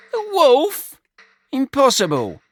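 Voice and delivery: jolt, then refuse to believe it; shocked disbelief. A narrator's reading. Brian hearing the wolf is back, shocked.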